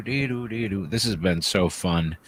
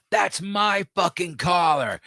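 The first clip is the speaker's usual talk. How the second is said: slow, higher mock shout; mock angry lady; 9 semitones higher, slower than usual. Joking about a lady fighting over a collar, slow mock shout. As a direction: ham up an angry shopper. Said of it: "And then the lady said".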